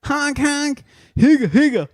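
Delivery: high goofy honks and whoops; silly clown. Pretend. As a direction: clown around like a party horn. Silly car celebration noises.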